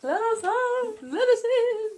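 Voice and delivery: creepy pleading. Pretend. Playing a ghost at the screen begging entry, creepy. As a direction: plead eerily in a high, stretched voice. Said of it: "It's pretty creepy".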